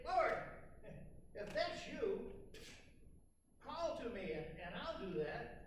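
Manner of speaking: Peter calls out eagerly to Jesus; Peter calling out. Acted.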